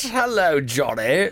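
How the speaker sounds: ordinary delivery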